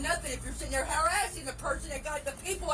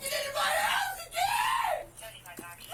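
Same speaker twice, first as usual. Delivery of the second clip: very high, slow wailing scream with sobs; shrieking sob; 13 semitones higher, much slower than usual. Real, not acted. Woman wails at police who broke in, shrieking sob. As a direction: wail it out, half laughing, half crying.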